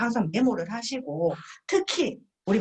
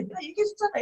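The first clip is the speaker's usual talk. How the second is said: smug told-you-so; 11 semitones higher, faster than usual. Retold or imitated. Partner needling the friend, smug. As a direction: nag that you said it already. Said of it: "민망을 주는 경우가 있어요".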